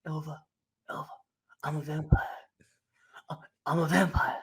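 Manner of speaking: hushed, halting mumble with long pauses; mock dazed whisper. Retold man muttering over fallen woman.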